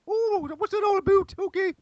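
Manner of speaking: very high falsetto caricature; put-on, piping high voice; mock swooning woman. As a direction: squeal it as a silly falsetto woman. Mimics a woman swooning at accent.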